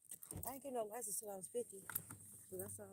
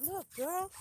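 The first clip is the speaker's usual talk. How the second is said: Jesus voice; 9 semitones higher, 11 dB louder than usual. Retold or imitated. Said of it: "Jesus said".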